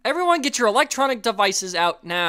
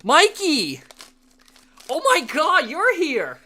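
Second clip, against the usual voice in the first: excited; 6 semitones higher, 3 dB louder, slower than usual. Real, not acted. Greeting a viewer who joined.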